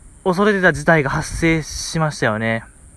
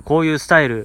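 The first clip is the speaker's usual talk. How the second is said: ordinary delivery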